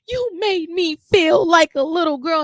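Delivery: mock childish accusation; whiny complaint. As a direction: plead like a wounded kid. Imitating hurt complaint to him.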